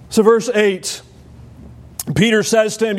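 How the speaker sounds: ordinary talk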